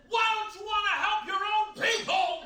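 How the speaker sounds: high-pitched indignant outburst, imitated; furious mock shouting. Furious fundraiser to comedian in the airport, mock shouting. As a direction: scold him like an outraged fundraiser. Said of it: "she started shouting at me in the airport".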